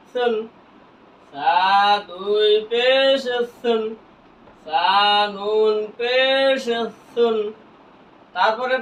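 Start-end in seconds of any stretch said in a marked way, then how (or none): 1.3-3.8 very slow, vowels held long; slow recitation drill for learners; drawn-out spelling. Shown as a demonstration. Same spelling repeated drawn out. 4.7-7.4 very slow recitation drill; each letter name stretched evenly; drawn-out spelling. Shown as a demonstration. Same spelling repeated drawn out.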